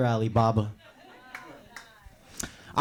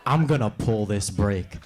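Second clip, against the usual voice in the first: slow threat; 7 semitones lower, slower than usual. Retold or imitated. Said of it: "and she's like".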